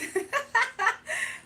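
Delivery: loud, high-pitched burst of laughter; laughing. Real, not acted. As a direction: crack up loudly, high in pitch. Laughing at own joke about mods.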